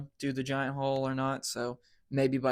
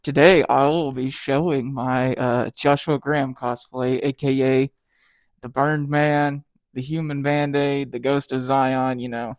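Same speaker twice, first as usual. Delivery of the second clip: muffled through a face mask; talking through a balaclava and gauze; 8 dB louder than usual. Real, not acted. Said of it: "understand me right now as I'm talking through a balaclava and gauze".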